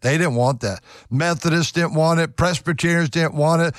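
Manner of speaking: ordinary delivery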